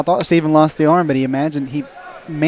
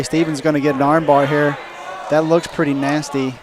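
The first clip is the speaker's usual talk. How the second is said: normal talking voice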